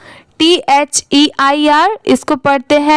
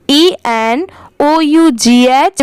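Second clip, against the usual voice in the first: ordinary delivery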